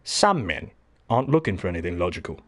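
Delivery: low, quiet, measured English butler voice; lightly voiced Michael Caine, calm and grave; a hint of the old butler explaining the villain; Michael Caine impression. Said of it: "Yeah, Michael Caine".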